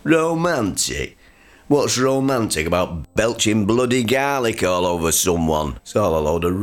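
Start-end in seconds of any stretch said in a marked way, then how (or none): 0.0-1.2 scornful echo. Acted. Dad scoffing at Mum.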